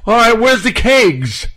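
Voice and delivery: high, loud, slow mock party-guy voice; imitating Canadian guy. Retelling a Canadian at a Christmas dinner, mock rowdy voice. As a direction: ham up a rowdy guest asking for beer. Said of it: "he goes".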